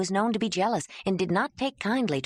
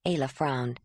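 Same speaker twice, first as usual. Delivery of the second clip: ordinary delivery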